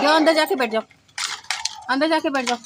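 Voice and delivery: loud, high command with falling ends; calling out orders. Real, not acted. Sending the screamer inside to sit, loud high ordering.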